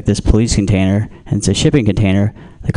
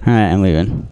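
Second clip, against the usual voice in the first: ordinary talk, nothing marked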